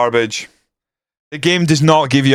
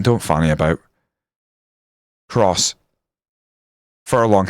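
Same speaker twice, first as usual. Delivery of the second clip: normal talking voice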